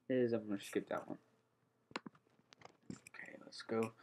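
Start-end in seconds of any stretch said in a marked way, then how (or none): 2.9-3.9 soft breathy whisper, slowed down. Real, not acted. To viewers, introducing the next game in a whisper. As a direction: hush it like a secret.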